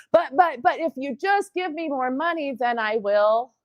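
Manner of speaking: stammered, high-pitched protest; acted pleading. Acting out woman begging for money.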